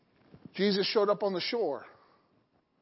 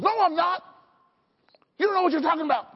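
flustered denial; 7 semitones higher, 3 dB louder, much faster than usual. Retold or imitated. Peter denies to the girl.

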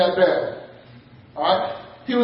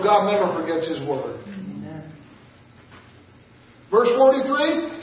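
usual speaking voice

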